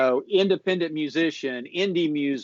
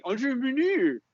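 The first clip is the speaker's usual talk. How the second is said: mock a weird accent on "Entrepreneur", much higher; hammed-up weird accent; 10 semitones higher than usual. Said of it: "maybe I got a weird accent and I say".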